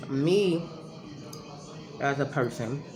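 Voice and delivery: talking while chewing gum; words round a wad of gum. Real, not acted. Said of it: "I'm chewing my gum".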